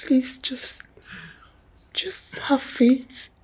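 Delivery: soft whispered plea to her partner; pleading whisper. Acted. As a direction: breathe it, begging him to calm down. Wife pleading softly in quarrel.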